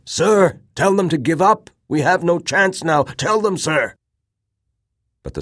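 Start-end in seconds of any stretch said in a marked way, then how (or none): 0.0-4.0 high, loud pleading shout; desperate plea. A narrator's reading. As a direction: beg the commander at full voice. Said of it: "He looked toward his commander".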